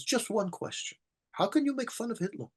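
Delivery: lightly voiced old lady pushing her point; pressing old lady.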